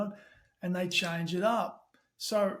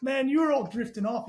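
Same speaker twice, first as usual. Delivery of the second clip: pitched up, incredulous; can't believe the praise, push it up high; disbelief; 6 semitones higher, 4 dB louder than usual. Retold or imitated. Replying to the client, high and astonished.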